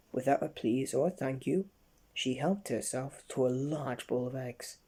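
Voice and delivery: ordinary delivery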